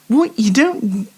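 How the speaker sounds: ordinary talk, nothing marked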